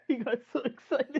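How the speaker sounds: high, giggly laughing voice. Real, not acted. Player teasing the game master's excitement, laughing.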